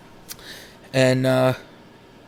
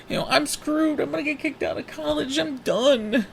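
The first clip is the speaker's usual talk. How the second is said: panicked; 13 semitones higher than usual. Retold or imitated. Panicked despair.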